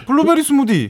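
블루베리 스무디 in heavy Busan dialect; strong Busan dialect. Shown as a demonstration. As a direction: laugh through a thick Busan 블루베리 스무디. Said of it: "너는 좀 심각하네, 사투리가".

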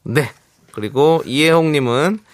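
ordinary talk

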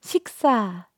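slow, hushed actress-style ask; a gentle drama-heroine line, drawn out; soft actress tone. Shown as a demonstration. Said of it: "약간 요런 톤 있죠".

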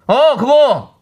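high, loud snapping; nagging wife. Acted. Wife losing patience with husband. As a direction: snap at him impatiently.

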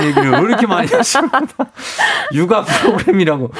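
high laughing delivery. Real, not acted. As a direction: laugh through the question. Laughing at listener comments. (웃음 섞인 말투)

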